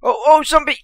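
high, loud alarmed cry; startled shout. Real, not acted. Zombie appears at night.

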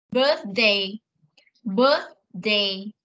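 "Birthday." with tongue between the teeth for the th; th sound demo. Shown as a demonstration. Teacher showing the class the th sound in birthday. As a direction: model the th in the middle of "Birthday.". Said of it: "cô phát âm âm thờ đấy".

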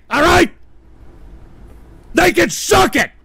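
ranting at freeloaders in chat, shouting; furious rant. Real, not acted. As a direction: tear into the chat at full volume.

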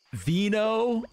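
high, delighted question; excited. Real, not acted. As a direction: squeal it with glee. Excited at finding wine.

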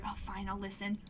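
ordinary delivery, nothing marked

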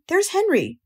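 put-on high-pitched girl spotting her friend; lightly voiced excited girl; excited cry. A narrator's reading. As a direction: call out the sighting, pitched high. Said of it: "cried Fern, pointing".

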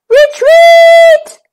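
high, loud command; shouted order. A narrator's reading. The Duke shouting his men back down the hill. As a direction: bellow the retreat to the troops. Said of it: "ordered the Duke of York".

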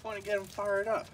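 ordinary talk, nothing marked

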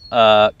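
"uh" drawn out into a distinct final K; focus on K. Shown as a demonstration. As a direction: hold "uh", then click the K. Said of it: "focusing on the K sound of".

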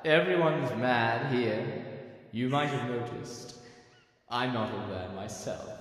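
normal talking voice

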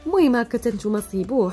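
ordinary talk, nothing marked

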